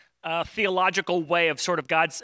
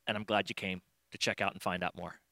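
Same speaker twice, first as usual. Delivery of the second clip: gentle affirmation; 9 semitones lower, 10 dB quieter than usual. Retold or imitated. Jesus gently affirming Nathanael.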